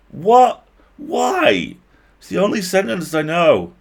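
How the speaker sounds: high, loud panicked squeak; Murray protesting in alarm, high and loud. Acted. Murray panicking, defending himself.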